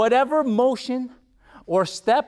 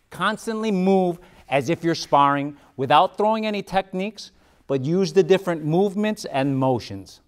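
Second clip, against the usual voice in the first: ordinary talk, nothing marked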